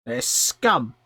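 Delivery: loud goblin disdain; scornful creature voice; contemptuous goblin. Acted. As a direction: spit out contempt for the rival town. Goblin disparages rival faction.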